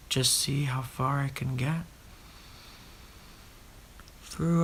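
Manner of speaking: normal talking voice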